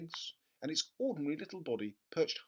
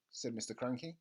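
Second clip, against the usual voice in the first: plain reading voice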